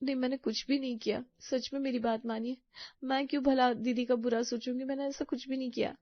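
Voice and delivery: soft, hurt pleading; pleading innocence. Acted. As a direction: plead quietly to be believed. (धीमी आवाज़ में गिड़गिड़ाते हुए सफ़ाई)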